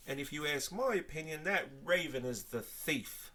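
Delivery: accused brother pleading high and loud with the sergeant; desperate protest. A narrator's reading. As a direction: beg them to believe you. Toby protests innocence desperately.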